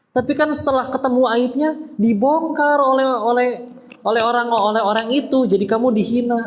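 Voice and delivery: high-pitched, insistent mock protest; objector's voice. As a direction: play a worried objector, pitched well up.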